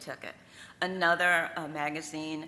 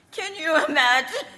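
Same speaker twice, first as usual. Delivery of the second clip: high, loud laughing amazement; laughing disbelief; 12 semitones higher, 11 dB louder than usual. Real, not acted. Telling the audience about company cars, laughing.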